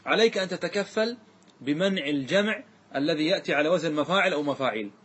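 ordinary talk, nothing marked

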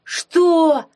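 furious roar, high and loud. Acted. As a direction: bellow it in outrage. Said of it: "взревел Дурнев".

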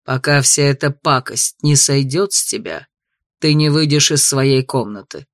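stern father voice. Acted. Duke punishes daughter.

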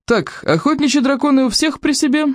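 high barked question; barking. A narrator's reading. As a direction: bark it out. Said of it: "гаркнул Плевака".

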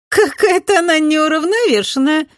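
high, loud, scornful snort; snorting girl. A narrator's reading. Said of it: "фыркнула Ада".

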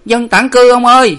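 put-on loud, high boy's cry; pleading child's call. A narrator's reading. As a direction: shout urgently to the sentry who you are. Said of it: "giọt miệng kêu lên".

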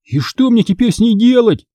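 high, anxious question; agitated. A narrator's reading. Boy holding the magic card, unsure, agitated. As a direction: ask with rising agitation. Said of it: "взволнованно спросил Конор".

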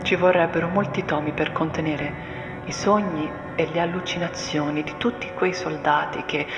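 usual speaking voice

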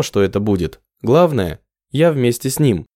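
usual speaking voice

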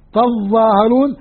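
تظاهرون slowly with a heavy doubled ظ. Shown as a demonstration. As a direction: lean into the ظ of تظاهرون. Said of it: "أكثر شدة".

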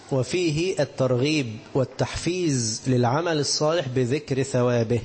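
ordinary talk, nothing marked

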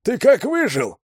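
high, loud, hoarse joyful cry; joyful rasp. A narrator's reading. Kruger greets Zhorzhik over the radio, joyful and hoarse. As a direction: rasp his name with delight. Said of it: "радостно просипел голос".

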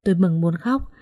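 ordinary delivery, nothing marked

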